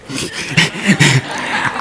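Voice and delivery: soft laugh. Real, not acted. Laughs at memory.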